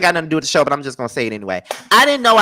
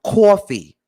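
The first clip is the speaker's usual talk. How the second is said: higher New York "caw-fee"; New York accent; 4 semitones higher, 4 dB louder than usual. Retold or imitated. Showing how New York people talk, exaggerated New York accent. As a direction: hit "Coffee" with a rounded New York vowel. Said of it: "I love the way New York people talk".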